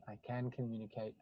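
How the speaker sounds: softly, "I can communicate" as a settled truth; quiet inner knowing. Shown as a demonstration. Coach quietly models calm self-knowledge, said softly. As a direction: let "I can communicate" sink in, hushed and sure. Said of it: "not "I can communicate," 'cause it sounds like".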